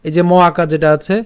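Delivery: normal talking voice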